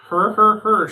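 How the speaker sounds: loud snorting mock laugh. A narrator's reading. Dog's Breath laughing at Hiccup, loud snorting. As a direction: snort it out, hammed up. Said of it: "snorted Dog's Breath".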